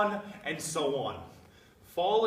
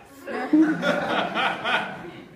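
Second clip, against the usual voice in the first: scoffing laugh; 5 semitones higher, 6 dB louder than usual. Acted.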